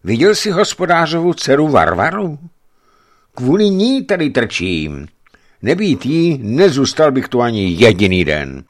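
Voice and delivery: put-on high, loud, earnest young giant; giant confiding. A narrator's reading. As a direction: pour out why he stays.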